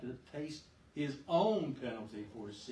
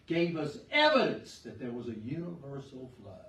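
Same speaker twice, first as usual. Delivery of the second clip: usual speaking voice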